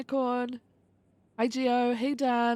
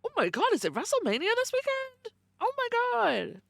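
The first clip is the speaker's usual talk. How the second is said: much higher, gasping "Oh my God" at WrestleMania news; mock shock, pitched way up; over-the-top surprise; 13 semitones higher than usual. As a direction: act stunned that WrestleMania is this weekend. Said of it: "I don't know why I said that like that".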